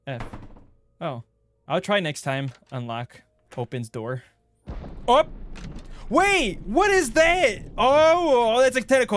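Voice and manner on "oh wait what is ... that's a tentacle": high, loud panicked yelling; panicked shriek. Real, not acted. Streamer panicking as a tentacle appears, high shriek.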